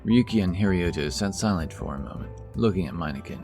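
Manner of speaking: usual speaking voice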